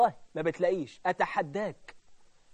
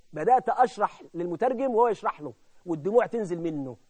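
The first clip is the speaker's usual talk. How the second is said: ordinary talk, nothing marked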